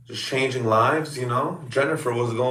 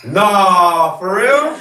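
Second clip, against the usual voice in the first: high, loud for real, voice jumping up; stunned disbelief; 7 semitones higher, 6 dB louder, much slower than usual. Real, not acted. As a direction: hit by huge news, can't believe it. Hears friend is pregnant.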